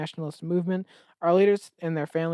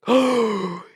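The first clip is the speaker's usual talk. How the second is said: mock gasp; 5 semitones higher, 7 dB louder than usual. Contestants react to the idol.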